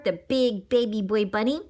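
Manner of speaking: plain reading voice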